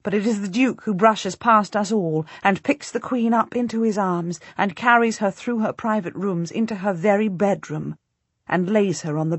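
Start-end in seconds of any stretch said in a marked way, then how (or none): none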